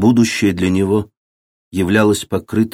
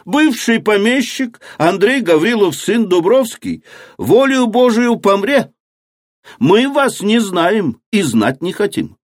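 defiant crowd; 12 semitones higher than usual. A narrator's reading. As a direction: shout back in defiance. Peasants defy the officials.

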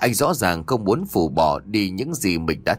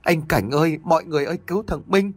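high, climbing cry for help; mother screaming for help for her son; calling for help; 7 semitones higher, faster than usual. A narrator's reading. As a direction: call out desperately to everyone. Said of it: "Bà Hương liền gào lên".

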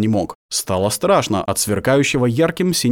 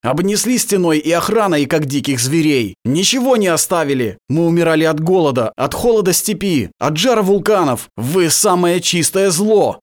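raging at the accuser, louder and higher; furious shout; 7 semitones higher, 4 dB louder than usual. A narrator's reading. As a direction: let the anger pour out. Turan's furious outburst.

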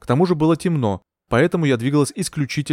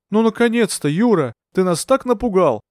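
high-pitched relieved scolding; reproachful; 8 semitones higher than usual. A narrator's reading. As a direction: scold a friend who scared you. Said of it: "укоризненно сказал ему Скоробогатов".

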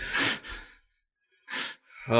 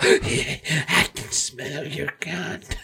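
slow, very quiet creep; hushed, leering lunatic impression; creepy madman; 6 dB quieter, much slower than usual. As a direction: draw it out softly like a deranged prisoner. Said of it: "that crazy schizophrenic guy".

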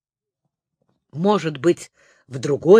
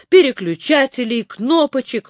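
ordinary delivery, nothing marked

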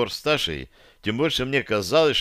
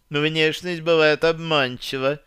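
higher, slow and airy put-down; dismissive retort; 6 semitones higher than usual. A narrator's reading. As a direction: toss it off with a verbal shrug. Таша свысока отвечает врагу. (снисходительно, нараспев)

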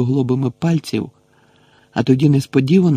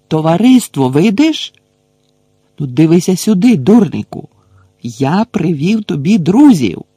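shouting; 5 semitones higher, 6 dB louder than usual. A narrator's reading. Gamekeeper hollering up at the giant, loud and high. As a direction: keep hollering to catch his eye. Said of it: "знову крикнув Гегрід".

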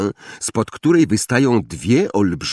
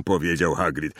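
ordinary delivery

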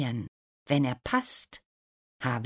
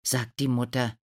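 plain reading voice

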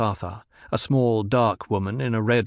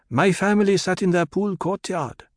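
higher-pitched character voice; Hera's ritual recital; 8 semitones higher than usual. A narrator's reading. Hera recalling her family in the ritual, high recital. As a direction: recite it in a raised voice.

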